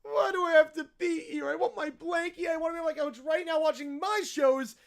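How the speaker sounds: put-on bratty child rattling off grievances; petulant, dragged-out little-kid complaining; whiny kid. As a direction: whine like a kid mid-tantrum. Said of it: "peak whiny kid territory".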